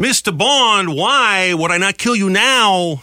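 slow, high mock Bond villain; Christopher Walken villain. Host imitating the villain taunting Bond, slow and menacing. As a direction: drag out the threat like a movie baddie. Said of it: "Christopher Walken, he's the best villain".

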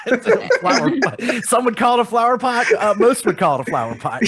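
high-pitched, giggly insistence; laughing through it. Real, not acted. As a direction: squeak it out through rising laughter. Host goofing on repeated phrase.

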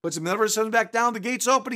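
ordinary talk

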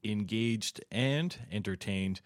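high, loud, bright show-intro announcer read; announcer opening. Acted. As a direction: open the show like a radio announcer, big and upbeat. Host opens the show.

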